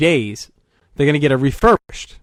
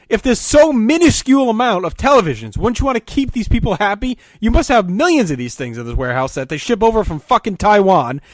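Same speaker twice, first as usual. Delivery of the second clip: angry complaint; 6 semitones higher than usual. Retold or imitated. Son arguing with the Vizio manager, angry and raised. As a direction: snap back at the defensive manager.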